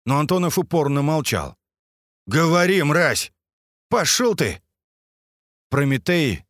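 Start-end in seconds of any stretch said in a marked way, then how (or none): 2.3-4.6 angry shout. Acted. Threatening a captive at gunpoint.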